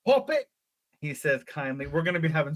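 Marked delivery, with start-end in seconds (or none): 0.0-0.5 put-on high, loud old English shepherd's greeting; funny old-country English accent. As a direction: hail them in a put-on rustic English accent. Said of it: "he says kindly in that funny accent".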